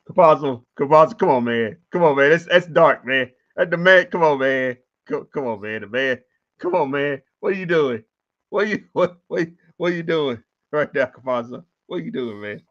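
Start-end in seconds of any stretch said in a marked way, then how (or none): 0.1-9.2 louder, exasperated come on man; groaning disbelief at a bad play. Real, not acted.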